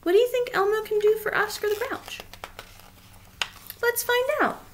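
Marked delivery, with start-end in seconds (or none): none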